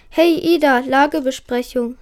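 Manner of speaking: ordinary delivery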